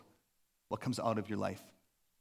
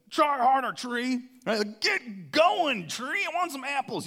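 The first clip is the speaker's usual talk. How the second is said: high, loud mock yell; yelling at a tree; 10 semitones higher, 6 dB louder, slower than usual. Pastor mocking someone yelling at a tree, loud and high. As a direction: bark orders at a tree in jest. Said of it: "yell at it".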